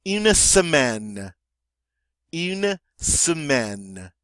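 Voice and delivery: very slow "une semaine", each syllable spelled out; French model pronunciation. Shown as a demonstration. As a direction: draw out "une semaine" for the class to repeat. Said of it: "And that is how you say week".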